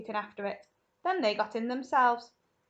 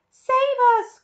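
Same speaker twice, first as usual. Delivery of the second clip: high, loud terrified cry; cried; 13 dB louder than usual. Acted. Grandma Josephine cries out in terror, high and loud. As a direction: shriek for rescue. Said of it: "cried Grandma Josephine".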